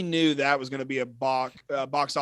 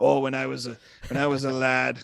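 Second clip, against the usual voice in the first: loud, mock Kiwi storyteller voice; imitating a crisp New Zealand accent; 6 dB louder than usual. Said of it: "his crisp New Zealand accent".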